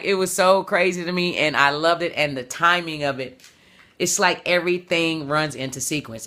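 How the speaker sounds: ordinary talk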